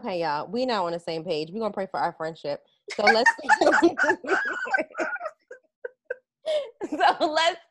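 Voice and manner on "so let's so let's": laughing through the words. Real, not acted. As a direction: try to talk through the giggles. Laughing while speaking.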